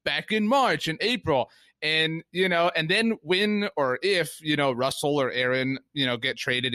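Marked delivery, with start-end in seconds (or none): 0.1-1.5 mock smug fan. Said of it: "fans that will be like".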